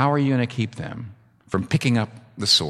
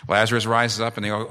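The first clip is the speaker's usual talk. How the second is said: ordinary talk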